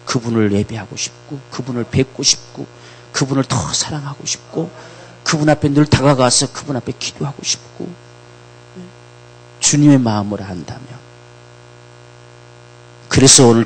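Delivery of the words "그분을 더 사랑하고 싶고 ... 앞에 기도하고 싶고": ordinary talk, nothing marked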